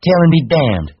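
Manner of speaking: high, loud defiant cry; loud high-pitched woman's outburst; crying out angrily. A narrator's reading. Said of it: "she cried".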